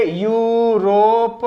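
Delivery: Europe stretched very slowly; drawn-out word. Shown as a demonstration. Teacher stretches the word for pupils to hear. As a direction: draw out Europe so each sound is heard. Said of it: "Europe देखिए".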